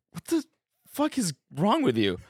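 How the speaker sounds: high, startled mock outburst; mock shocked partner. Reacting to a partner saying your full name, high mock shock. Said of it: "you're like".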